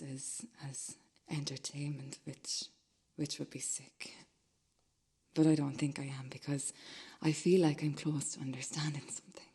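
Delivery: whispered, hushed and uneasy; guilty whisper. Acted. Man admitting to himself his watching might be sick, in a whisper. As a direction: hide the thought under your breath.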